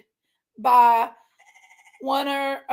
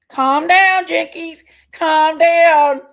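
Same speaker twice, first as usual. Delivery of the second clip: loud, higher-pitched order to yourself; worked-up self-command; 3 semitones higher, 7 dB louder, much slower than usual. Real, not acted. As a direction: talk yourself down at full volume. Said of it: "I need to calm down".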